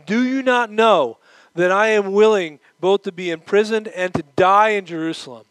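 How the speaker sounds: Paul shocked at the weeping. Retold or imitated. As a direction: demand why they weep, shocked. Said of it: "the Apostle Paul was shocked".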